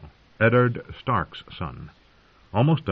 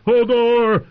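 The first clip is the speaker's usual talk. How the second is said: high, very loud roar; 8 dB louder than usual. A narrator's reading. Hodor terrified by a thunderclap, roaring. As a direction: roar the name full out. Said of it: "he roared".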